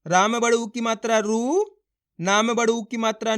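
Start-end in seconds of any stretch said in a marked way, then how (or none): 1.2-1.6 रू drawn out, gliding upward; long vowel. Shown as a demonstration. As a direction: hold the oo of रू and let it rise. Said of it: "खींच खींच के इसका उच्चारण".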